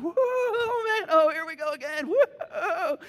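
high, loud excited cry; thrilled fear. Retold or imitated. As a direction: brace for another drop. Reliving coaster intensity.

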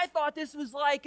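ordinary talk, nothing marked

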